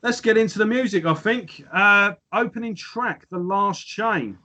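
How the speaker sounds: podcast host opening the track review; presenter voice. Acted. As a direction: launch the review segment. Starts the review section.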